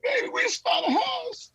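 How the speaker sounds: very high, squeaky crying squeal; mock tearful cry. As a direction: imitate blubbering happy news to a wife. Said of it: "And we're crying".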